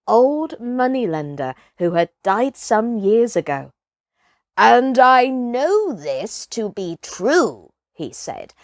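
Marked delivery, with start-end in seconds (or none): 4.6-7.7 put-on old hunter. Village hunter telling ghost tale.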